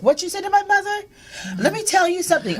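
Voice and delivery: put-on child defending her mother, fired up; feisty kid confrontation. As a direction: square up to whoever insulted mom. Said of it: "And I was like".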